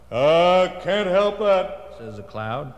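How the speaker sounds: the cloud refusing, stubborn. Acted.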